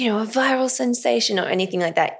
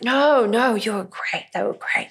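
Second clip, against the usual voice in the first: mock reassurance; 3 semitones higher than usual. Acted false reassurance to anxious actor.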